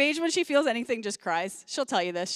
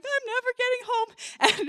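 mock wail pitched much higher; imitating bawling; 11 semitones higher than usual. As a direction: play her sobbing daughter, hammed-up. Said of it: "And she's bawling".

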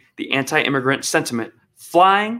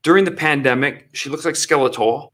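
normal talking voice

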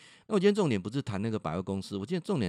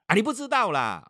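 high, loud acted snap back; mother's dismissive retort; 10 semitones higher, 6 dB louder than usual. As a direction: brush them off as the mother. Mother dismisses speaker, retold. (模仿妈妈不耐烦地顶回去)

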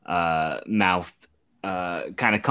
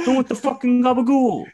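put-on high, louder Italian New Yorker accent; 6 dB louder than usual. Mocking Draymond as an Italian New Yorker, loud hammed-up accent. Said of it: "he's an Italian that lives in New York".

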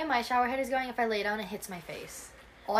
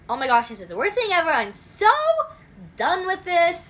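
louder, building exasperated rant that rises higher at the end; mock exasperated rant; 8 semitones higher, 9 dB louder than usual. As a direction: vent the bad day like it's the end of the world. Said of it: "I'll go in the longest way and be like".

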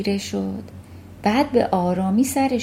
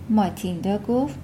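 usual speaking voice

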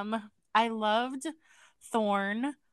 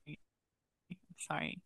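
breathy, whispered apology, much softer and slow; whispered sorry; 5 semitones lower, 18 dB quieter, much slower than usual. Real, not acted. Podcast host whispers sorry to cohost after laughing. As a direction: barely breathe the word sorry.

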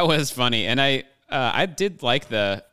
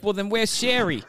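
higher-pitched mock Boston accent; Boston accent impression; 10 semitones higher than usual. Finishing the movie joke for friends, in a higher mock Boston accent. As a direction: ask it like the same Boston tough guy, pitched up. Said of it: "That was the same exact accent".

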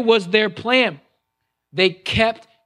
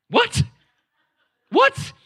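much higher, disbelieving double what; incredulous what. Acted. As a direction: ask it twice in shock, rising. Pastor's incredulous double take.

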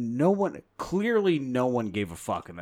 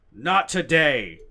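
very slow, much louder over-enunciated Ford; Harrison Ford impression; 3 semitones higher, 10 dB louder, much slower than usual. Shown as a demonstration. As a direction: stretch each word like Ford. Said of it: "you just do this".

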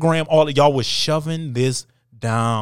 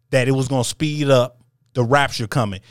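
ordinary talk

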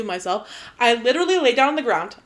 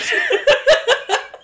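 loud high laugh; 8 dB louder than usual. Real, not acted. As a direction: giggle high and loud after reading. Laughs after reading her texts.

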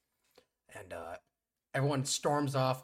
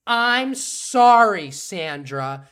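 loud mock apology; 4 semitones higher, 14 dB louder, much slower than usual. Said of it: "She's like".

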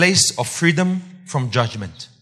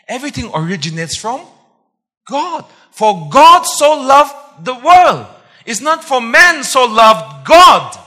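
emphatic preaching; 9 semitones higher, 11 dB louder than usual. Real, not acted. Preacher correcting the congregation's thinking with John 3:16, emphatic proclamation. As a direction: thunder it out with weight on the key words. Preacher forcefully contrasts two readings.

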